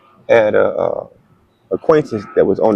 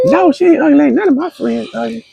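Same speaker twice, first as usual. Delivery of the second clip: high-pitched mock-indignant denial; indignant friend; 13 semitones higher than usual. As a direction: defend your friend, imitating her in a high voice.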